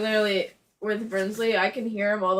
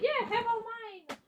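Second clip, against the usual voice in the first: mock cheerful voice; 13 semitones higher, slower than usual. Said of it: "I was like".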